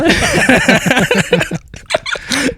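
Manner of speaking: high auto-tuned giggle; squeaky giggly alien. Real, not acted. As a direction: giggle squeezed through a pitch effect.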